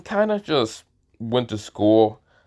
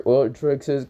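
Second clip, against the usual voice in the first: ordinary delivery, nothing marked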